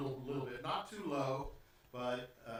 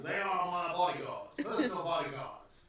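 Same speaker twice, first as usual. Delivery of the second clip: put-on measured southern-accented gentleman; 3 semitones higher, 3 dB louder than usual. Host introduces his bodyguard dogs, southern accent. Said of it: "Why is he southern?".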